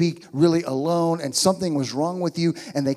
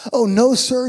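startled protest; 8 semitones higher, 5 dB louder than usual. Acted. Hannah protests in reading.

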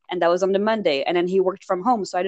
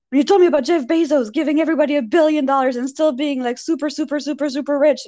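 high, fast imitation of an agitated coworker; worked-up rant; 8 semitones higher, faster than usual. Coworker confronting speaker about the Bezos fact, worked up. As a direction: rattle it off high, piling on the repeats.

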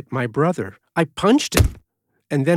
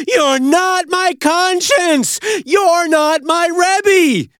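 high, loud, slow screaming; furious yell; 13 semitones higher, 5 dB louder, much slower than usual. Retold or imitated. Yelling at his brother mid-fight. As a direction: scream it with everything left. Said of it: "and finally I yelled".

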